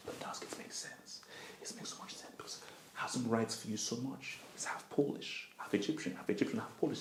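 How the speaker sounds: very soft, mostly whispered aside; conspiratorial whisper. Acted. Fan retelling the mouthpiece theory to himself, conspiratorial whisper. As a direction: whisper it like a secret.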